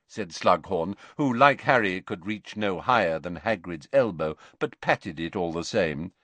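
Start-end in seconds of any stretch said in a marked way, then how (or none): none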